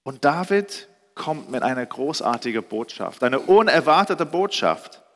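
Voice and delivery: normal talking voice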